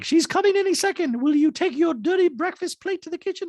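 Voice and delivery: Dorit impression. Dorit nagging PK about breakfast plate, high put-on voice. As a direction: squeak at him to clear his plate.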